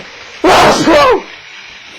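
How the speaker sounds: sudden loud sneeze, high and breathless; real sneeze. Real, not acted. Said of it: "just sneezed!".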